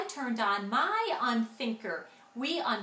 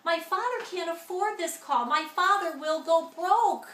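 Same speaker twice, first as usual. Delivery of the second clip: panicky plea; 5 semitones higher, 5 dB louder than usual. A narrator's reading. Panicked sister pleading.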